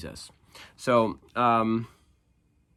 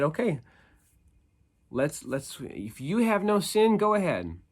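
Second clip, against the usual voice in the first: challenging Jesus; 5 semitones higher than usual. Retold or imitated. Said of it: "which is why he said".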